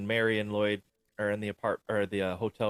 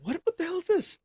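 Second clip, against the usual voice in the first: high, stammering mock indignation; hammed-up outrage, pitched way up; mock outraged disbelief; 4 dB louder than usual. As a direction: demand it in a high, incredulous voice. Acted outrage at empty briefcase.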